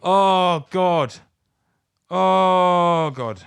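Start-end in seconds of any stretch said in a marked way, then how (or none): none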